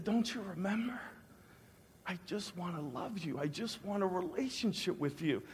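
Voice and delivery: very soft, intimate voice; tender reassurance. Retold or imitated. God telling a penitent man he is loved, tender. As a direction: reassure gently, almost under the breath. God's tender reply, retold.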